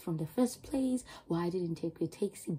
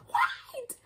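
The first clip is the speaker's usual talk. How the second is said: high, loud shocked cry; shocked shout; 4 dB louder than usual. Retold or imitated. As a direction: imitate friends gasping in alarm. Friends shocked as she crosses road.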